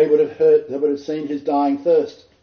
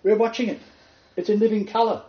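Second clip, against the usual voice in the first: amazed onlookers; 6 semitones higher than usual. Retold or imitated. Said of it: "And they're going".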